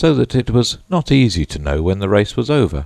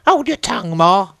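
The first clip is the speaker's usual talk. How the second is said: snappish. A narrator's reading. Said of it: "a little snappishly".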